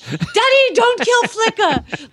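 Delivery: put-on high-pitched melodramatic little girl; little girl drama. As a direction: plead with daddy like a hammed-up child. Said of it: "little girl nightmare drama".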